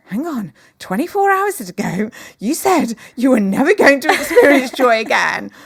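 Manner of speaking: as if you can't believe the turnaround; incredulous. Retold or imitated. Mother's astonished reply to daughter.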